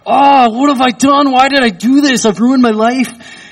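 high, anguished self-pity; despairing lament. Retold or imitated. Father in the car doubting the move, high anguished lament. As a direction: wail it like you regret everything.

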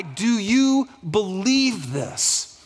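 slow, gentle, searching question; tender question. Retold or imitated. Preacher voicing Jesus asking grieving Martha, slow and gentle. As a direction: ask it slowly, as if drawing her in. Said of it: "And then he goes".

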